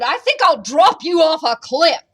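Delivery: booming storybook threat; bellowing giant. A narrator's reading. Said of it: "he bellowed".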